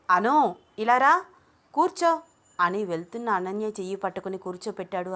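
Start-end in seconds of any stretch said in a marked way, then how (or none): 0.1-2.1 raised, higher-pitched short orders; loud, insistent summons; firm command. A narrator's reading. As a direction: call her over and order her to sit. Man firmly orders her to sit.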